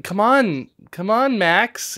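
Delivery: mimicking ghost daughter. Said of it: "she's like".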